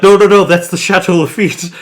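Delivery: high, loud panicked protest; alarmed shout. Retold or imitated. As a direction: stop him in a panic. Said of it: "Robert Grant's like".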